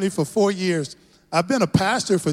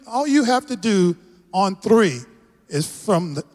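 ordinary talk

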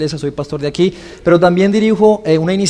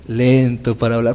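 slow demo cue; 9 semitones lower, 5 dB quieter than usual. Shown as a demonstration. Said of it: "Y cuando diga, "Lento para hablar".

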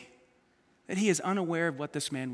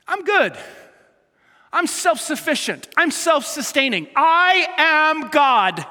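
smug self-sufficient boast; 7 semitones higher, 7 dB louder, slower than usual. Retold or imitated. Preacher acts a proud self-sufficient man.

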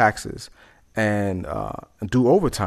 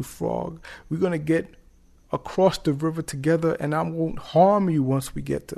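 higher-pitched, earnest-sounding promises; pleading scorpion; 6 semitones higher than usual. Acted. Scorpion swears not to harm frog, high pleading voice. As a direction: plead in a raised creature voice, dropping on "frog".

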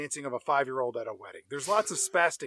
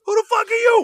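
a hammed-up yell thrown straight back, much higher and louder; mock shouted retort; 10 dB louder, faster than usual. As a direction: bark the same question right back at her in a mock yell. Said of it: "To which he responds".

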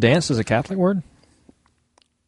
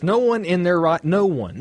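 ordinary delivery

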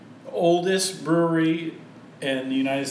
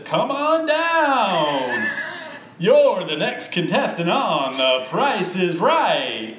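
slow, loud, higher game show call; game show announcer; 4 semitones higher, 5 dB louder, much slower than usual. Retold or imitated. Friend mocking host as game show announcer, loud and slow. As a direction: boom it out like The Price is Right announcer. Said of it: "the guy that says".

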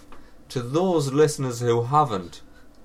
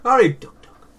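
high, loud playful call-out; playful shout; 12 semitones higher, 9 dB louder than usual. Acted. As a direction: shout it out gleefully.

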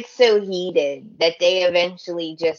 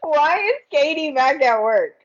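high, laughing voice; 8 semitones higher than usual. Real, not acted. Fan laughing at her own question, high laughing voice. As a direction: giggle through the question. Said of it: "laughing at own question".

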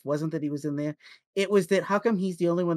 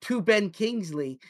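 normal talking voice

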